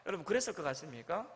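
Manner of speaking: ordinary talk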